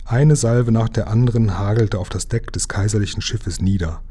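ordinary delivery, nothing marked

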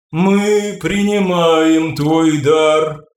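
high, slow, ringing otherworldly voice; ringing crystal chime voice. Acted. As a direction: speak like a chime from beyond. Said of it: "негромкий звенящий голос".

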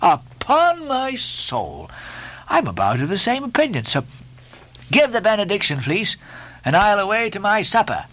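breezy ship's mate, pitched high; Stubb, jovial mate. A narrator's reading.

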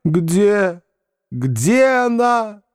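slow, high-pitched theatrical wail; feverish delirium. A narrator's reading. Foma seeks his lost innocence, raving feverishly. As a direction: draw the question out as if delirious. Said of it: "как будто был в жару и в бреду".

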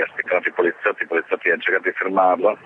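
usual speaking voice

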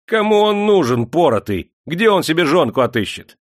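high, loud jeer; mocking. A narrator's reading. Demidov ridicules the flogged lad as husband, mocking. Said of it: "насмешливо улыбнулся".

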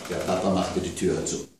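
plain reading voice

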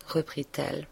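ordinary delivery, nothing marked

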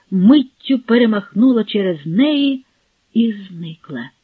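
ordinary delivery, nothing marked

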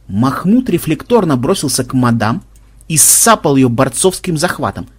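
ordinary delivery

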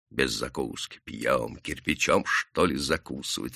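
low, quiet grumble; бывший дьякон. A narrator's reading. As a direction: grumble it softly as an ex-deacon. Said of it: "Бывший дьякон". (негромкое низкое ворчание)

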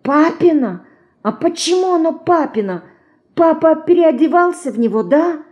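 put-on high, wondering child voice; a small girl asking in amazement; wide-eyed little girl. A narrator's reading. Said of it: "зашептала ей на ухо Динка". (высокий удивлённый детский голос)

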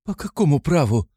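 high-pitched indignant challenge; offended. A narrator's reading. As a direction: demand it in wounded protest. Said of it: "вскричала обиженная девушка".

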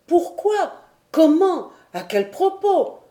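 high, urgent burst of questions; volley of questions. A narrator's reading. Travellers besieging the old sailor, eager high-pitched questions. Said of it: "questions simultanées qui venaient frapper Tom Austin".

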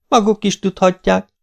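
magas hangú, félvállról vett megjegyzés; foghegyről odavetve. A narrator's reading. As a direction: vesd oda kioktatóan. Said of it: "vetette oda a két sintérnek". (odavetett, magas hangú válasz)

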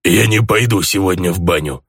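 grumpy orc voice on phone; grumbling orc. A narrator's reading. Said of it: "проворчал орк".

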